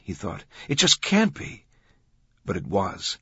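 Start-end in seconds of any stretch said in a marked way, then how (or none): 0.7-1.7 much higher, louder disbelief; mounting disbelief. A narrator's reading. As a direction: insist in astonishment, pitch leaping up. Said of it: "in silent wonder".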